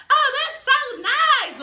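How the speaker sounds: hammed-up fake-polite "Oh, that's so nice". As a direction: gush with obviously fake sweetness. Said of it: "Like, so fucking fake".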